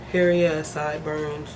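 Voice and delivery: ordinary delivery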